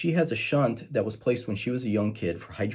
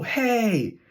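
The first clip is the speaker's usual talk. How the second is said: much higher indignant yell; girl's angry shout; 12 semitones higher than usual. Retold or imitated. Patient just out of a coma yelling at her surgeon, high indignant yell. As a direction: play the patient waking up furious. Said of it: "I hear this voice go".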